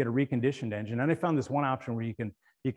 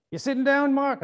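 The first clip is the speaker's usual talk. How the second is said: much higher and louder New York urging; New York mechanic; 13 semitones higher, 6 dB louder than usual. Retold or imitated. Mechanic urging caller to sit down, high and loud. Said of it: "New York voice".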